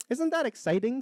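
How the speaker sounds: high-pitched thrilled little egg; excited. Acted. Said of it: "shaking with excitement".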